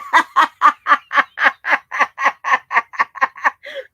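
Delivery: long, loud staccato laughing fit; loud belly laugh. Real, not acted. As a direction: burst out laughing and keep going. Cracking up at the story.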